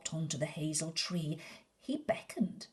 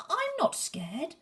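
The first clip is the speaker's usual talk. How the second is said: high, defiant little voice; high brave boast; brave child; 12 semitones higher, 4 dB louder than usual. Acted. Gruffalo's child insists she's not scared, high.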